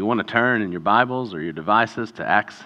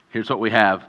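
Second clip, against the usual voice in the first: ordinary delivery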